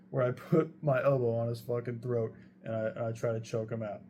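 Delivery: normal talking voice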